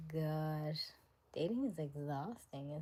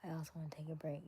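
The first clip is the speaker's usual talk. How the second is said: whisper; 8 dB quieter, much faster than usual. Real, not acted. Tired aside, dropped to whisper.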